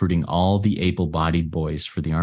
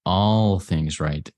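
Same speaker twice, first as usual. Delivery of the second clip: ALL drawn out slowly; stress on ALL; much slower than usual. Shown as a demonstration. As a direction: stretch out the word "all". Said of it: "I like to emphasize the word all".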